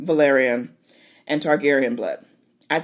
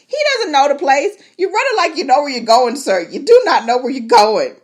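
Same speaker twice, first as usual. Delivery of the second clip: higher, louder mock reproach; teasing scold; 7 semitones higher, 6 dB louder than usual. Real, not acted. Telling the fleeing rider off, loud teasing. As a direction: call him out with a laugh.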